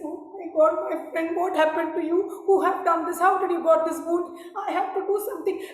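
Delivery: high, loud anxious pleading; distressed friend demanding answers; worried alarm. Acted. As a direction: devotee asking the wounded Shiva who did this, alarmed. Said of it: "He said".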